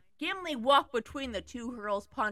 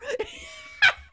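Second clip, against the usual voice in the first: shrieking laugh; 6 dB louder than usual. Real, not acted. Laugh after scream.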